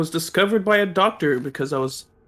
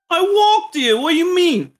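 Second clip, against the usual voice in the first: pitched way up and loud, puzzled bravado; high indignant Timmy voice; 10 semitones higher, 6 dB louder than usual. Acted. Timmy Smalls answers the doctor, high and louder. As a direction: squeak out a cocky retort.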